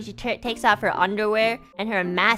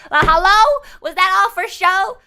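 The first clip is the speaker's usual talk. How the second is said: higher, louder, offended rant; indignant rant; 7 semitones higher, 4 dB louder than usual. Retold or imitated. Ranting at the judgmental stripper, high and loud. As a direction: rant at her, fully offended. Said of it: "gave this woman a look like".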